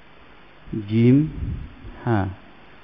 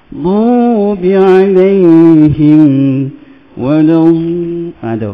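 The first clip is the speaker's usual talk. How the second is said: loud recitation; 6 dB louder than usual. Shown as a demonstration. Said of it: "Macam tu".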